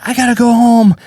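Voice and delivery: high, fretful goodbye; acted flustered exit. Comic excuses himself to friends after bombing, flustered. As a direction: make hurried excuses in a high voice.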